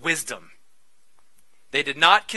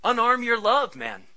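ordinary talk

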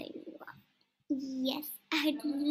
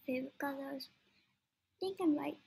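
ordinary talk

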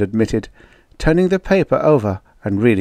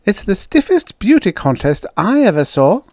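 high-pitched wistful complaint; acted touch of regret; 12 semitones higher, 5 dB louder than usual. A narrator's reading. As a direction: lament it, voice pitched up. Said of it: "said the hefty brunette wistfully".

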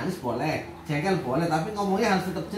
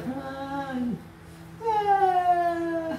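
high, slow mock wailing child; imitating a crying child; 11 semitones higher, much slower than usual. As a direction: ham up her tearful blubbering. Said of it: "nangisnya itu". (menirukan anak menangis dengan suara tinggi)

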